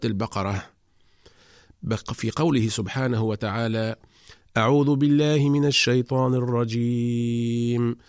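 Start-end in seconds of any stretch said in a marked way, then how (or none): 4.6-8.0 slow, melodic tajweed chanting; measured Quran recitation; Quranic recitation. Shown as a demonstration. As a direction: chant the verses slowly and evenly.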